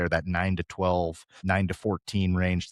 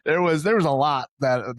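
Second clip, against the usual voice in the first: laughing; 7 semitones higher, 4 dB louder than usual. Real, not acted. Laughing about problematic accents.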